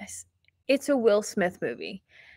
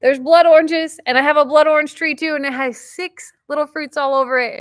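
high, loud burst of delight, easing off; real thrilled excitement; so excited; 6 semitones higher, 6 dB louder than usual. Real, not acted. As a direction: gush over a happy discovery. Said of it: "and I'm so excited".

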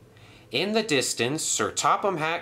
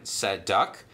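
usual speaking voice

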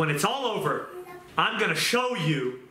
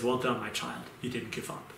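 softer, lower, gentle words of praise; tender God; 4 semitones lower, 8 dB quieter than usual. Retold or imitated. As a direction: say it softly like a proud father. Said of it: "And he says".

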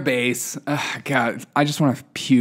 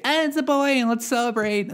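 mocking party voice; 9 semitones higher than usual. Mocking gender reveal parents.

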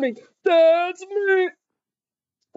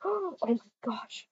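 breathless whispered gasp; gasp, then whisper it slowly; slow scandalized whisper; 10 dB quieter, slower than usual. Real, not acted. Gasped whisper at couple.

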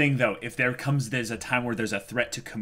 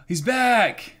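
high, loud squeal of excitement; real, high-pitched excited shout; excited cry; 14 semitones higher, 8 dB louder than usual. Real, not acted. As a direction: cry it out, thrilled at the return. Reacting to a character's return.

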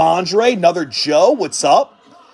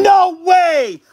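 excited shout; 7 semitones higher, 5 dB louder than usual. Real, not acted. Pulling a rare card live.

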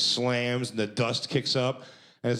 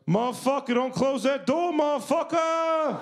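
mock yell. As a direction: imitate a guy hollering up the stairs in a high voice, dragging the last motherfucker. Host yells at him upstairs.

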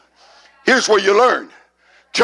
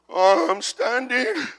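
slow, wobbly, higher-pitched groan; mock feeble standing; 4 semitones higher, 4 dB quieter, slower than usual. Shown as a demonstration. Preacher showing how not to stand, mock feeble. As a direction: act barely able to hold yourself up. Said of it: "You don't stand like this".

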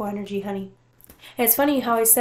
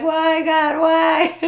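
mock wail; 6 semitones higher, 7 dB louder, much slower than usual. Imitating her crying for months.

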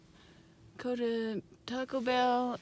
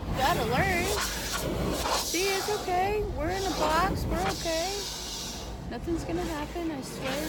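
reassuring; 7 semitones higher than usual. Real, not acted. Reassuring dog in box.

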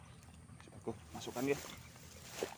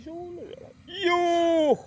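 a sudden cry pitched way up; high excited cry; 5 dB louder than usual. Real, not acted. Pulling the eel out, crying out in excitement. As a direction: yelp high as the eel comes out.

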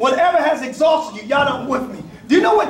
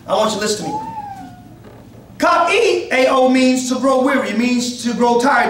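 ordinary talk, nothing marked

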